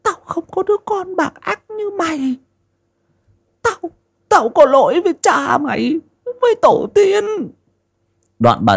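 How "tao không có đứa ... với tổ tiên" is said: put-on much higher, firm old woman's disowning; curt old mother. Old mother Ngạc disowns her son, high and curt. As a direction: cut him off in a high, unbending old voice. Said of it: "Bà Ngạc giấm dứt".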